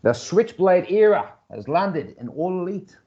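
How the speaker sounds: bad, high New Zealand accent impression. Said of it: "my terrible fucking New Zealand accent".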